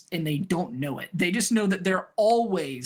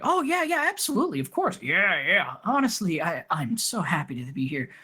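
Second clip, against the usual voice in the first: eager yes-men. Acted. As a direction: fawn over him, way too eager. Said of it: "they're like".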